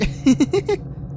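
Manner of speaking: high, rising giddy giggle; a gleeful little cackle. A narrator's reading. Pinky giggling at her captive, high giddy giggle. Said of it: "Pinky giggled".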